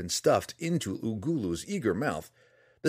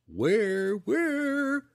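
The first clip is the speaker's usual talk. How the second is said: high, long-drawn monster wail; mock-spooky crooning; 5 dB louder than usual. A narrator's reading. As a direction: tease with a rising, drawn-out spooky howl. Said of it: "Flanny crooned".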